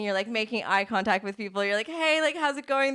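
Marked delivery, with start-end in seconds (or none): 1.9-2.9 high, upbeat hello to passers-by; breezy greeting. Pretend. Coach voicing someone greeting strangers on the street, breezily confident. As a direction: toss out a cheery, pitched-up greeting.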